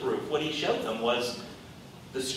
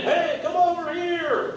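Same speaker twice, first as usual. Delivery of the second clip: very high slow call across a distance; mock scream; slower than usual. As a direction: call out to them far away. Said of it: "he's screaming".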